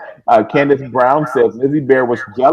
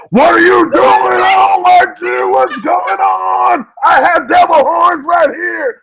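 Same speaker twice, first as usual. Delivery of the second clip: much higher, loud, slow crying shout; crazed Elmira; 3 dB louder, slower than usual. Retold or imitated. Cohost imitating Susan turning Elmira on David, shrieking. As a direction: shriek it like an unhinged cartoon girl. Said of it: "then she goes back to David Rosen".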